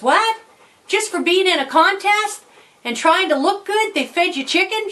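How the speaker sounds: put-on high-pitched incredulous boy's questioning; mock amazement. Boy questioning his mom about free chicken, amazed.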